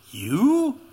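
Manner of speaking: high, disbelieving single word; Pilate's stunned "You?"; incredulous. Retold or imitated. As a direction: scoff at the idea in one word. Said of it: "emphatic and somewhat incredulous".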